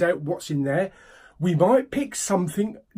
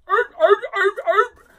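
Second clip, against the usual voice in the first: loud, high seal barking; seal barks; 6 dB louder than usual. Acted. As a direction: bark like an excited seal. Seal pun, then mentions flippers.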